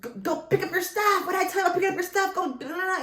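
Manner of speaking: put-on snapping at the kids like an exasperated mother; mom yelling. Mother yelling at teenage boys to pick up stuff, high mock yell. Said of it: "I yell".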